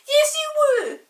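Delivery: very high, loud insisting; insistent girl. Acted. Molly insists to Peter, high and loud. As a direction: insist like a bossy sister. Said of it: "said Molly".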